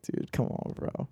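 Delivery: hushed, unvoiced aside to a buddy; low-key protest. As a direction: murmur it as a weary plea. Quoting himself as friend mimics him.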